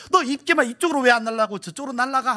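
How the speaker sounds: high, hurried mock scolding; mock anger. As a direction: pretend to tell off your own breath. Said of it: "입김에게 화를 내면".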